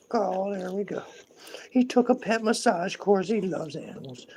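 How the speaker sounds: high, contented pampered pet; spoiled pet voice. Pretend. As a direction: speak as a pet basking in attention. Voices the pet enjoying the bed.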